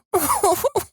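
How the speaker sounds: squeaky high giggle; high Marge laugh. Acted. Marge laugh after punchline.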